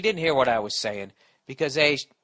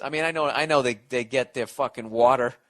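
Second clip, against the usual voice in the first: ordinary delivery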